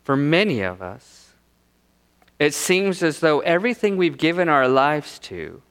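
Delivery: ordinary delivery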